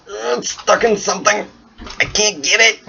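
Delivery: a confused shopkeeper tugging at a stuck sword; puzzled character voice. Acted. Harvey straining at the sword stuck in the ice, high and puzzled.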